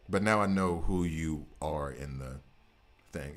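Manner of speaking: normal talking voice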